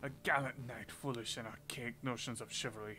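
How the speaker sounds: put-on measured French-accented tale; guarded French storyteller; French prisoner storytelling. French prisoner tells veiled story.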